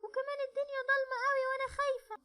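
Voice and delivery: put-on high small girl's voice, tired and scared; little girl. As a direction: whine like a worn-out little girl afraid of the dark. (بنت صغيرة تعبانة وخايفة، صوت عالي ورفيع)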